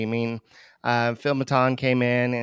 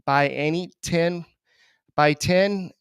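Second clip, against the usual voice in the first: ordinary talk, nothing marked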